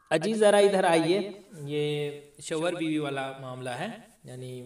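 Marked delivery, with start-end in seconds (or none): 0.1-1.2 raised, louder call of "अजी"; wife calling husband. Shown as a demonstration. Wife calling her husband to come over, louder call. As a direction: call your husband over from across the room. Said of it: "बुलाती है ना बीवी शौहर को".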